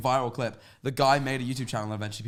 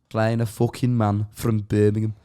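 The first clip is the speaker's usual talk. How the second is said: slow, put-on Brummie accent; Birmingham accent; 3 semitones lower, 3 dB louder, slower than usual. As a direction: lay the Birmingham accent on, slowly. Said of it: "I was about to say, that's pretty good".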